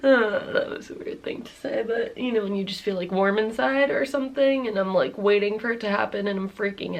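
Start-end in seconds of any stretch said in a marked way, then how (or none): none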